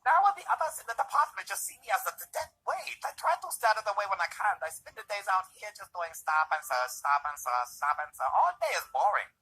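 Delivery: high, loud comic cop voice; hammed-up high-pitched caricature.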